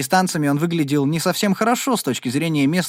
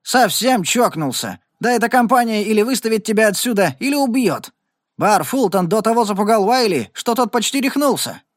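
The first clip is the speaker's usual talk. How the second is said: raised-pitch, incredulous warning; alarmed exclamation; 8 semitones higher than usual. A narrator's reading. Meadows warns Regan off the company, alarmed exclamation. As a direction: exclaim it high, warning him off. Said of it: "воскликнул он".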